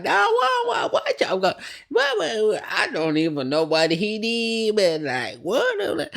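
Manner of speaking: high-pitched exaggerated country accent; hammed-up country-accent squawk; Candy. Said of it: "Candy gives us real country accent".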